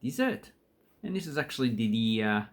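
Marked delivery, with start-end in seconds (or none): 0.0-0.5 dessert said with a high lift on the second syllable, des-SERT; dessert, stressed end. Shown as a demonstration. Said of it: "the noun".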